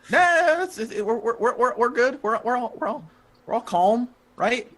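high, stuttering reassurance; nervous peacemaking. Acted. Edgar calming the fight, nervously placating.

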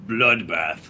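a low, deep, soft Bloodbath said slowly; Rage's bloodbath. Shown as a demonstration. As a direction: growl Bloodbath low like Rage. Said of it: "Say it".

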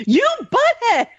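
mock angry shout. Playful mock outrage over donut.

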